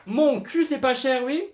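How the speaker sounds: loud, high, scoffing retort; sarcastic. Real, not acted. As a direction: scoff at it loudly. Mocking his own old claim.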